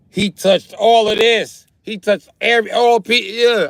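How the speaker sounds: high, loud, hammed-up disgust; comic horrified outcry. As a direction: stretch it out in loud mock horror. Comic horror at being touched.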